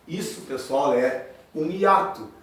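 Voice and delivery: ordinary talk, nothing marked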